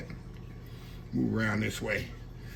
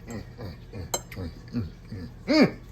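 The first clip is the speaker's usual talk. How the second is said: savoring hums; 6 semitones lower than usual. Real, not acted. Savoring first bite on camera.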